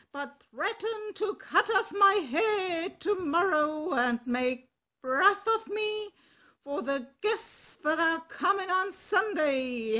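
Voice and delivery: a cock complaining about his mistress; indignant rooster. Acted. Cock character speaks.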